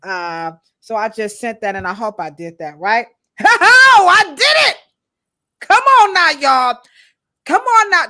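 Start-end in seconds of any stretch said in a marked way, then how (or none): 3.4-4.8 laughing, high, loud cheer; whooping with success; triumphant. Real, not acted. Celebrates sending the text. 5.6-8.1 loud, high rallying shout; hyped-up holler to the audience; rousing call. Real, not acted. Rallies viewers to follow along.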